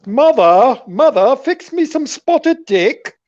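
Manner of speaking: mock English calling out. Calls mother in mock English.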